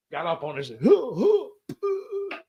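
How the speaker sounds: breathless gasps getting up; gasping. Retold or imitated. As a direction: imitate a dazed man gasping for air. Acting out Myers getting up gasping.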